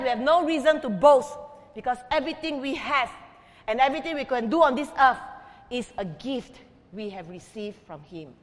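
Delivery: ordinary talk, nothing marked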